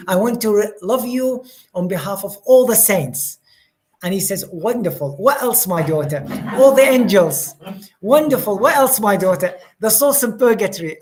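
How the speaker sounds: a hint of Jesus, rising with joy; voicing Jesus. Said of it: "but he's like".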